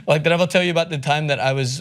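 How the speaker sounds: normal talking voice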